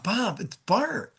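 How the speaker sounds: drawn-out greeting as if to someone known; familiar, unhurried greeting. Shown as a demonstration. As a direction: stretch out "Bob, it's Bart" and let it hang. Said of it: "And hesitate for a minute".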